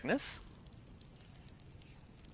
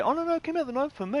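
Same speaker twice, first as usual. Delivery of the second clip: much higher, fast mocking voice; mocking foreign gamer; 12 semitones higher, faster than usual. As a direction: parody a know-it-all gamer, high and quick. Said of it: "somebody on the other side of the planet's gonna go".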